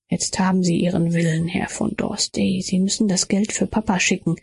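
usual speaking voice

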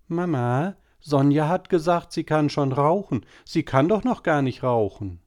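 a hint of a chattering girl at dinner; little girl. A narrator's reading. Girl telling on her sister to mama, lightly childlike.

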